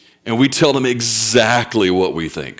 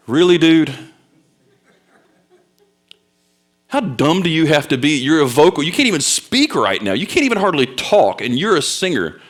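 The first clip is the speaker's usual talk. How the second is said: high, incredulous dressing-down; disbelieving rant at a buddy; exasperated scolding; 5 semitones higher than usual. Retold or imitated. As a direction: lay into a foolish friend, pitch raised. Retold scolding of pierced friend.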